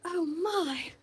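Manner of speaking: high, fluttery oh my; a startled old lady gasp; flustered mother. Acted. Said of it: "and she's like".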